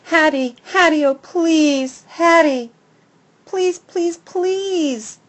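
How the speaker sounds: high, rising begging whine; a dog whimpering for food; whining plea. A narrator's reading. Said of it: "I whine some more".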